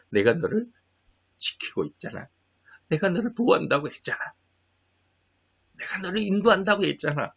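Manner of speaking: a hint of God's quiet reassurance; soft, gentle voice of God comforting; God speaking softly. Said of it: "그래서 하나님이". (하나님이 조용히 다독이듯 말하는 목소리)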